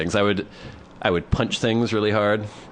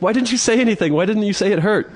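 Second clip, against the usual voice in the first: put-on incredulous girlfriend; 12 semitones higher, 3 dB louder than usual. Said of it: "It's like".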